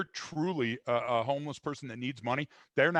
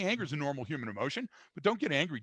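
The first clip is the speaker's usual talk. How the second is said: ordinary talk, nothing marked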